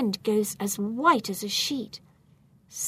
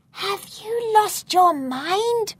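put-on high, slow alarmed girl; girl's voice; 11 semitones higher, 5 dB louder, slower than usual. A narrator's reading. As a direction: scold him in a panic. George scolds Gaston.

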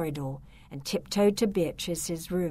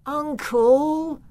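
pleading moan; 9 semitones higher, 7 dB louder than usual. A narrator's reading. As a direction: moan the word uncle. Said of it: "moaned Victor".

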